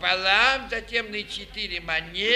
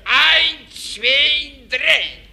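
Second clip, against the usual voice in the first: громкое заклинание; 3 semitones higher, 8 dB louder, much slower than usual. Acted. Фокусник произносит заклинание.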